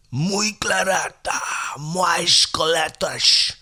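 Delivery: slow, loud, high mock-Portuguese full of sh sounds. Imitating the Brazilians, hammed-up mock Portuguese. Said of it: "you have to shh a lot when you're talking like that".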